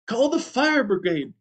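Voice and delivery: high-pitched call for help. A narrator's reading. Missus Prothero calling for help over the gong, high-pitched call. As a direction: call it out high, without extra volume. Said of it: "called Missus Prothero as she beat the gong".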